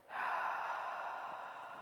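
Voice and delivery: soft, drawn-out exhale; long sigh. Real, not acted. Teacher taking one last deep breath, soft long sigh. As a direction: breathe out slowly through the mouth.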